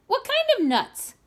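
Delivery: high, loud, eager question; monkey begging to know what nuts. A narrator's reading. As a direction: squeak it out hungrily. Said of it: "cried the monkey".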